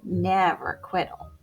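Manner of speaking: ordinary delivery, nothing marked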